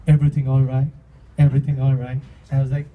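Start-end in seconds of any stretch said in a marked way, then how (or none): 0.1-0.9 imitating waiter check-in. 1.4-2.2 same waiter check-in. Retold or imitated.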